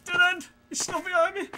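high frightened yell; panicked shout. Real, not acted. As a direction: yell in alarm. Cornered again.

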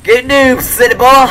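high, loud Texan twang voice; Texan accent. Pretend. Friend asks gamer to do the voice, Texan accent. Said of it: "can you do it in the voice?".

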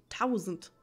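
usual speaking voice

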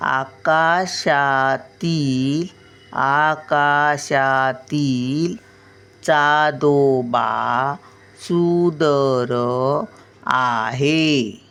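put-on slow, drawn-out reading for small children; slow reading aloud. Shown as a demonstration. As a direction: read each word slowly, like a grandmother teaching. (मुलांसाठी हळू, ओढून वाचन)

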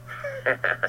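a sharp mocking snarl of a laugh; short snarling laugh. Georg pinned under the tree, snarling laugh. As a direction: bark out a short cruel laugh. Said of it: "gave a short, snarling laugh".

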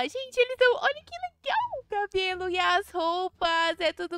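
high, loud thrilled gushing; breathless delight over the new family; excited gushing. Pretend. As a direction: rave about the colorful hair and clothes. Admiring the family's colorful look.

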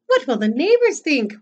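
high-pitched motherly worry; worried mother. Acted. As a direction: fret aloud as an acted storybook mother. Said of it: "worried his mother".

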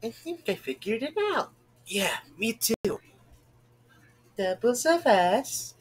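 overacted anime dub. Voicing game characters.

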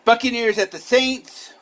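announcer lift. Real, not acted. Announcing next matchup.

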